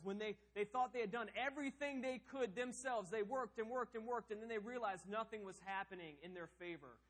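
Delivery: high, loud preaching, the last words slowed; fiery pulpit preaching; preaching at full force. Acted. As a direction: preach it with rising fire. Preaching on desperation.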